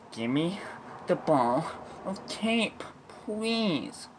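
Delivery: emphatic, broken-up demand climbing high; exasperated demand. Retold or imitated. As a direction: punch it out piece by piece, rising to a high plea. Principal's escalated demand, acted.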